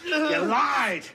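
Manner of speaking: high, shouted scorn with bitter laughs; mocking angry rant. Tormentor mocking Billy's wasted life, furious and jeering. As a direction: laugh at him while you tear him down.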